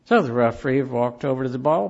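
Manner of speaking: ordinary delivery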